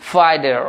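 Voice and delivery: ফাইটার with t softened to d; American pronunciation. Shown as a demonstration. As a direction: say ফাইটার as "fai-derr". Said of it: "তাহলে American".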